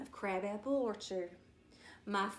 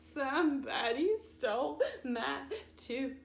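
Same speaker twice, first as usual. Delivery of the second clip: sobbed; 5 semitones higher, 3 dB louder than usual. A narrator's reading. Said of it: "I sobbed".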